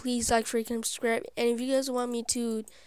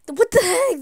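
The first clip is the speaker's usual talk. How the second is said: high, loud indignant outburst; outraged yell; 8 semitones higher, 12 dB louder than usual. Real, not acted. Player yelling at being blocked off in the game, outraged. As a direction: burst out in shocked protest.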